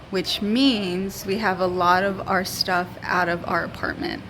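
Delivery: echoey empty room; hallway echo. Real, not acted. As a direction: voice bouncing off bare walls. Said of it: "there's some echo to my voice".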